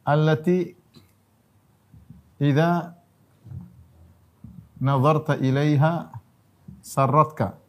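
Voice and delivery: slow, measured Arabic recitation; reciting the hadith slowly to the congregation. Acted. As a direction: chant each phrase with long pauses.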